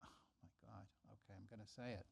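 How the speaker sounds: hushed secret whisper. Real, not acted. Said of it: "I just whispered it here".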